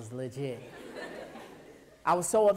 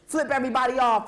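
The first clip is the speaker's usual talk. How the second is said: loud rant; 5 semitones higher, 6 dB louder than usual. Retold or imitated. Reenacting rage.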